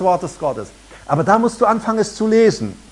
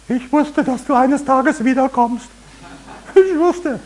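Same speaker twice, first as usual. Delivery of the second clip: much higher, eerie taunting tone; hammed-up spooky taunt; creepy voice of Fear; 8 semitones higher, slower than usual. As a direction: gloat like a ghost that returns. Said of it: "wird sagen".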